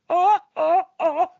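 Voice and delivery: high, loud mock laughing "oh, oh, oh"; a hammed-up sitcom laugh, high and loud; Ricky Ricardo laugh. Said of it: "That's Ricky Ricardo laugh".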